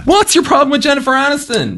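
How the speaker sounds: high-pitched indignant demand; indignant challenge. Real, not acted. Co-host won't watch Jennifer Aniston's film, indignant challenge.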